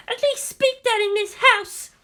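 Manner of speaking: very high, shrieking demand; screeching demand. A narrator's reading. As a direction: keep screeching the order. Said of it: "she screeched".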